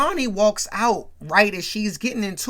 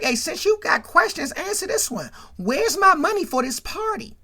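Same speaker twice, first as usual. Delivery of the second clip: high-pitched mock demand; 9 semitones higher than usual. Sister demanding money from her brother, demanding. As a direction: demand your cut, voice up high.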